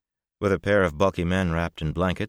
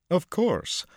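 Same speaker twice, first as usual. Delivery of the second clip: eager; 4 dB louder than usual. A narrator's reading. Beaming Lucca answers eagerly.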